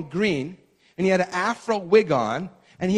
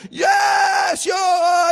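high, loud whooping yell; hollering fan; 12 semitones higher, 6 dB louder than usual. Retold or imitated. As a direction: holler like a crazed fan in the stands. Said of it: "they're screaming and hollering out there".

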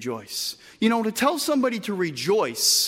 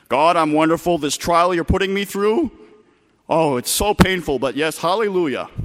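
mock brave face; 4 semitones lower, 5 dB louder than usual. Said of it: "Don't be so stoic".

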